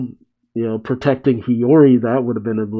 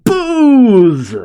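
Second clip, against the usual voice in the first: loud, high drawn-out comic yell; yelling awake; 11 semitones higher, 5 dB louder than usual. Retold or imitated. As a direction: holler it like a demand. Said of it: "Zoro awakens yelling".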